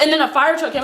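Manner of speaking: normal talking voice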